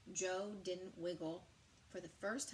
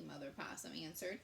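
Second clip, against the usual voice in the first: ordinary delivery, nothing marked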